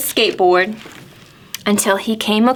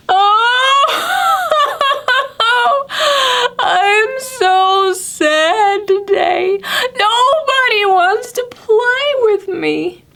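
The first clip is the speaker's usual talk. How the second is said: high, slow hammed-up sobbing voice; fake-blubber like a lonely gator; mock crying alligator; 14 semitones higher, slower than usual. Lonely Alligator crying to Pete, hammed-up sobs.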